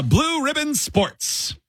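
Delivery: high, slow Scottish read; put-on Scottish accent; mock Scottish host. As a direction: announce it like a Scottish quizmaster. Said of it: "It's supposed to be Scottish".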